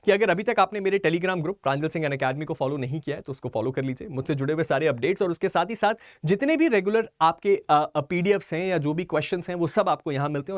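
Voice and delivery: ordinary talk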